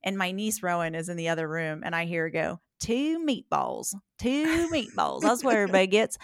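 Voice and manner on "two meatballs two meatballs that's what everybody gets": mimicking a twangy Appalachian accent. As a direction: drag out the Appalachian twang, much higher. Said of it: "Is that seriously what I sound like?".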